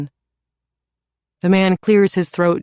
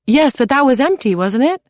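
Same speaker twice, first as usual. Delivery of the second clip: high, louder unconcerned mother; breezy mother; 9 semitones higher, 4 dB louder than usual. A narrator's reading. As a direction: brush it off lightly. Mother character voice.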